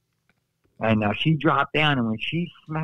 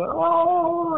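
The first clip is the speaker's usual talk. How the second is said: piercing high yelp; high falsetto cry; 4 dB louder than usual. Retold or imitated. Still holding her arm, one more falsetto cry. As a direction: squeal it out, way above his speaking pitch.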